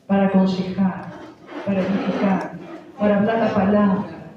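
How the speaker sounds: rally the congregation like a pulpit preacher; fiery preaching. Acted. Preaching at full force.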